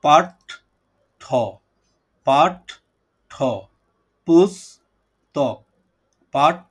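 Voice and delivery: "পাঠ ঠ, পুস্তক" in drawn-out, separated syllables; slow syllable breakdown. Shown as a demonstration. Teacher breaking a student's word for the class, "পাঠ ঠ পুস্তক" slowly syllable by syllable. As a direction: split "পুস্তক" as পুস, pause, তক. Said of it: "ধীরে ধীরে উচ্চারণ করি".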